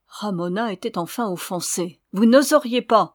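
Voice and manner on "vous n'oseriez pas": loud, raised protest; offended cry. A narrator's reading. Ramona challenging the signora, offended cry. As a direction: cry out in defiance. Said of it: "s'écria-t-elle".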